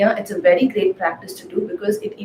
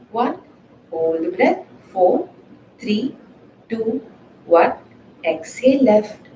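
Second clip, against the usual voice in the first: calm, measured counting of breaths; guided breathing count; slower than usual. Acted. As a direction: count the breath slowly and evenly. Guided alternate nostril breathing count.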